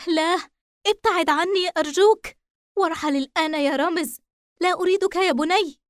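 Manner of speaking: high, sharp mother's rebuke; harsh angry refusal. A narrator's reading. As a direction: push him away in anger. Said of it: "بغضب شديد وقسوة".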